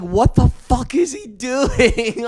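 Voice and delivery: slow, high, stretched-out outrage; exasperated disbelief. Retold or imitated. A friend watches the talker chat with a stranger, slow high disbelief.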